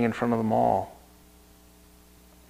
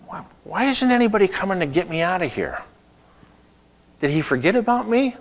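worried prisoner; 8 semitones higher, faster than usual. Acted. Joseph voiced in the prison.

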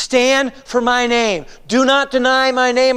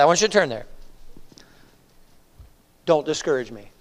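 usual speaking voice

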